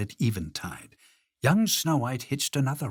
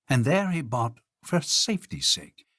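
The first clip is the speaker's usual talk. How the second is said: usual speaking voice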